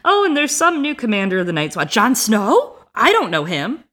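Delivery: high, breezy, dismissive chatter; airy Miranda. Retold or imitated. Imitating Miranda in the Vale, high and airy. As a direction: toss it off like a careless gossip. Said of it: "Miranda's like".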